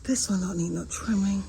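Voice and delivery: breathy whispered aside about the palm tree. Real, not acted. Filming her yard, she whispers an aside to viewers. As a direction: whisper it like a private joke.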